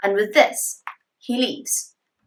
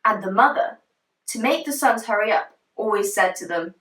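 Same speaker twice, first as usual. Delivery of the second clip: plain reading voice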